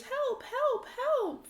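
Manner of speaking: high-pitched distant-style calls; cry for help. Acted. Archeon's voice is heard in the fog, high cry for help. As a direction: call for help high, moderately loud.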